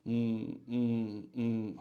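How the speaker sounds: slow, drawn-out ums; hesitant filler hums. Shown as a demonstration. Teacher imitating a candidate translating in his head, slow ums. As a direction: hum slowly like someone searching for words. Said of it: "try to avoid that".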